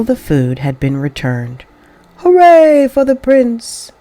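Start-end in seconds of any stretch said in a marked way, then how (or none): 2.2-3.9 high, louder, slow cheer of the crowd; happy crowd cheer. A narrator's reading. Townspeople hailing the prince for returning food: joyful cheer. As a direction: cheer him like a grateful crowd. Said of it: "shouted the happy crowd".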